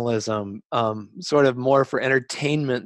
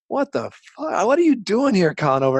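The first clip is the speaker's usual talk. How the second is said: much higher, louder mock-incredulous challenge; incredulous bunkmate; 10 semitones higher, 4 dB louder than usual. Bunkmate confronting the undercover writer, mock incredulous and loud. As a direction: play the disbelieving ex-Marine calling him out.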